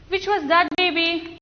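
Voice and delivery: usual speaking voice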